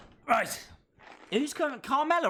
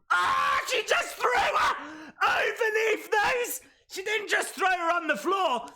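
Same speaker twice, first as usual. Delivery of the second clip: shouted high with amazement; excited shouting; 8 semitones higher than usual. Real, not acted. Streamer reacting to a wild throw, shouting high in disbelief. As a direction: yell it like a stunned fan. Reacting to a big wrestling move.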